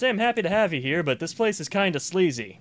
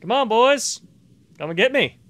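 louder and higher taunt at the enemies; taunting call; 6 semitones higher, 7 dB louder than usual. Real, not acted. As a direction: dare them to come at you.

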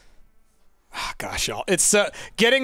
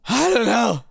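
playful exclamation; 3 semitones higher than usual. Real, not acted. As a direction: call it out with raised pitch. Playful reply to supporter's question.

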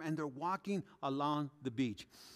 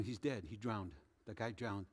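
hushed; 9 semitones lower, 10 dB quieter than usual. Retold or imitated. Lifeguards announcing death, hushed retelling.

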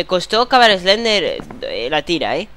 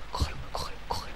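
whispered urging; 15 dB quieter, faster than usual. Real, not acted.

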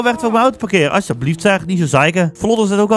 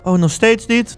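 usual speaking voice

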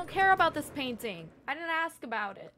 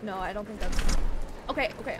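ordinary talk, nothing marked

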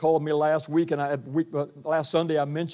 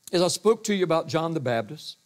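ordinary talk, nothing marked

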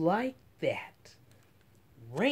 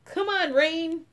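high, loud cheer; 11 semitones higher, 8 dB louder than usual. Acted. A girl cheering on the coming rain. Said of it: "I cheer".

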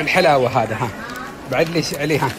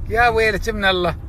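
ordinary talk, nothing marked

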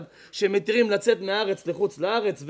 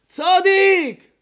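long, loud call from afar; drawn-out shout; 9 semitones higher, 9 dB louder, much slower than usual. Retold or imitated. A bystander calling to the man in the boat, loud drawn-out shout. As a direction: call out the word slowly across the water.

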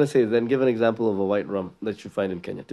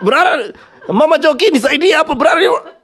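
high, stammering, laughing; stumbling over Bralirwa, much higher; struggling Kikuyu speaker; 14 semitones higher, 4 dB louder than usual. Retold or imitated. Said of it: "a Kikuyu will never survive saying that".

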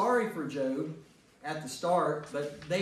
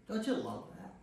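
ordinary talk

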